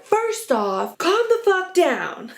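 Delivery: mock snap, slow and high on the last words; snapping. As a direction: play her snapping, drawing out calm the fuck down. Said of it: "Danielle snaps".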